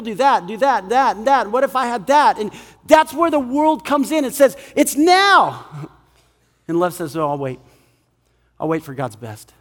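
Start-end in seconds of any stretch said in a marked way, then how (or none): 4.7-5.6 high, punchy demand; insistent demand. Acted. The world demands, preacher voices it. 7.2-9.5 low, soft, patient voice; patient, tender reply. Acted. Love answers the world's demand.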